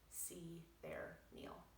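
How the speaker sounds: slow breathy whisper, drawn out. Acted. Doctor whispers the quoted phrase to her readers. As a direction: let the words fall to a hush. Scare quote on seeing meal.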